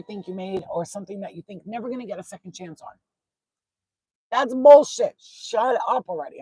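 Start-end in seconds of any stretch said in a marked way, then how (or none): 4.3-6.4 loud, high, cutting dismissal; irritated retort. Retold or imitated. Reader relays what she is hearing, irritated retort. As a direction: burst out loud, fed up. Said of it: "I'm hearing".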